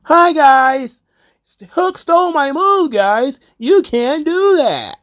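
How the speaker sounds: high, loud mock commentary yell; Chris Jericho yelling. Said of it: "Jericho's yelling in my face on commentary 'cause that's how his new character talks".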